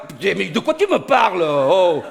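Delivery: high, loud comic fury; mock-furious shouting, rising to a threat; outraged retort. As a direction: explode at the insult to your accent. Outraged retort about his accent.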